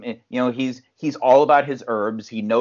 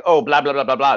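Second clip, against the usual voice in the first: fake foreign language; 4 dB louder, faster than usual. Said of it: "speaks, like, fake Chinese".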